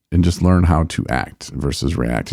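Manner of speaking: ordinary talk, nothing marked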